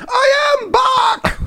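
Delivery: very high, slow mock shout; imitating drunk shout. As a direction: mimic her lunging yell. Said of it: "She, like, lunges forward".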